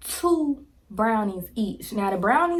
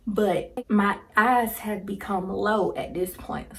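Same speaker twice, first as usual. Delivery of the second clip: ordinary talk, nothing marked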